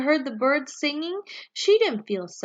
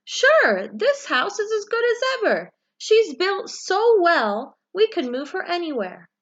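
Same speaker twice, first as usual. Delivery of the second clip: movers' voice; 6 semitones higher, 4 dB louder than usual. A narrator's reading. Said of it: "The movers looked the little house all over and said".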